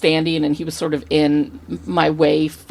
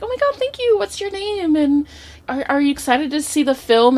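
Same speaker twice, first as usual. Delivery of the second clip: much higher, gushing imitation of a delighted actress; gracious star; 11 semitones higher than usual. Actress thanking a fan who shook her hand, gushing. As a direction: put on a high, sweetly thrilled voice.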